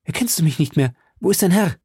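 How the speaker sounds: impatient rush of questions; urgent calling. A narrator's reading. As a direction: demand answers in a high, urgent voice. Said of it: "rief der junge Mann".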